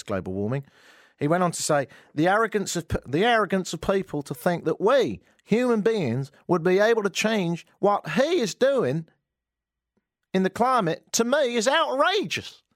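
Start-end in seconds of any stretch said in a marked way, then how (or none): 2.1-12.6 mock Southern senator. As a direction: spit out the outrage, hammed-up. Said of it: "it was for effect".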